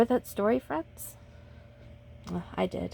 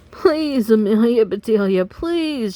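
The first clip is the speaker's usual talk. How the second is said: desperate shouted please; 7 dB louder, slower than usual. Acted. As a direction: beg her loudly from the bench. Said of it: "shouted the Grizzlies".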